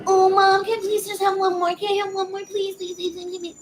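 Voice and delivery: put-on high-pitched whiny child pleading with mom; begging kid. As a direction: pester mom in a tiny high kid voice, running the pleas together. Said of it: "We don't beg and plead like that".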